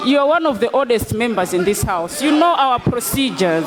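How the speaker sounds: stern shouted rebuke; shouting order order. Real, not acted. Speaker scolding member.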